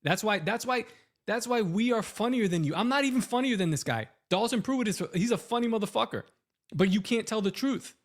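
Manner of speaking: high-pitched, worked-up scolding; riled-up telling-off of a rival; heated rant. Real, not acted. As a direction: let the real indignation push your pitch way up.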